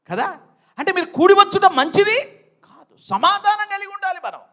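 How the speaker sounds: high, forceful exhortation; full-force preaching. Real, not acted. Preacher warning believers to make peace before breaking bread, full-force preaching. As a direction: press the warning home at full pitch.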